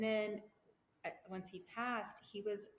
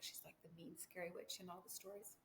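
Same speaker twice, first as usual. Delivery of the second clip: much softer, half-whispered thought; hushed aside; 9 dB quieter than usual. Retold or imitated. A child privately judging her grandmother, in a hush. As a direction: drop to a secret murmur.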